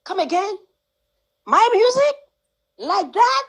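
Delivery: can't believe your own song sounds like this; stunned disbelief. Retold or imitated. Hearing her song remixed, asking in high amazed disbelief. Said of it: "and I'm like".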